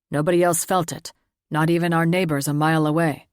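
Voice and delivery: a hint of a stern elder reporting news; Tor, lightly voiced gruff father. Tor's voice, low and louder.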